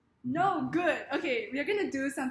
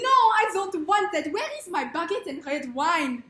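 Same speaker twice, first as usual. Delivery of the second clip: indignant, loud caricature French; French accent; 5 semitones higher, 8 dB louder than usual. Shown as a demonstration. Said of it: "how it would sound like in French".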